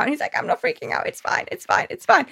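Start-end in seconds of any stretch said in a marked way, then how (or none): 0.4-1.9 high, breathless whispered panic; mock denial of panic. Flynn insisting to Rapunzel he is fine, breathless whispered panic. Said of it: "he is blatantly freaking out". 1.9-2.3 forced calm. Retold or imitated. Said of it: "he is blatantly freaking out".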